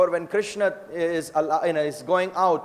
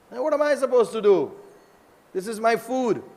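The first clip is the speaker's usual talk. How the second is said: mock exasperation, pitched well up; acted complaint of a thwarted tiger, high; indignant protest; 6 semitones higher than usual.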